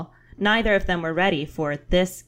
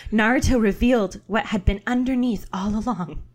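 usual speaking voice

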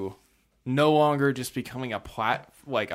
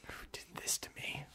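very soft, whispered lament; mock wounded whisper; 21 dB quieter than usual. Lied to about a pronunciation, mock wounded whisper. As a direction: whisper it like a hammed-up victim.

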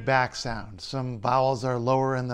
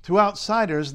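normal talking voice

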